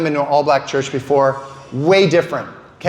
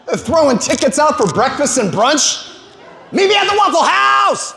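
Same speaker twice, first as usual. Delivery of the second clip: high, laughing party shout; hyped DJ shouting; 9 semitones higher than usual. Retold or imitated. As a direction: sell the brunch like a hype man. DJ hyping brunch tickets.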